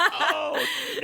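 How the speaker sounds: high mock "oh no" of a caught kid; high, caught-out dismay. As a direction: squeak it like you just got caught.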